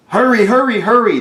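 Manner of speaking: high, loud urgent cries; urgent calling. Acted. Firemen urge each other on, loud high cries. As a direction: yell it like the house is burning.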